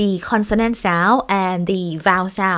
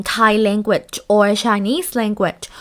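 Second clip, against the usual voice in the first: normal talking voice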